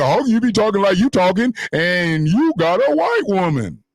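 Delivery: imitating the haters with a hint of scorn; mocking critics. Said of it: "But then they say".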